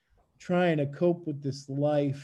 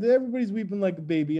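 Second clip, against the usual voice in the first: ordinary delivery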